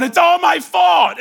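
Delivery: loud, wailing confession; weeping cry. Retold or imitated. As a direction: cry it out, a boy broken by regret. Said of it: "he's over here weeping, going".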